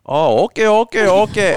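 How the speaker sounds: high, lilting imitation Indian accent; mock Indian accent. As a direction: mimic mom faking an Indian accent. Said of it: "Indian business travel people".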